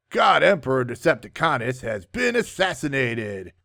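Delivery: ordinary talk, nothing marked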